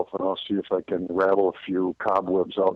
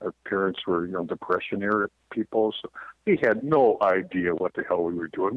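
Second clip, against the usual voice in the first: usual speaking voice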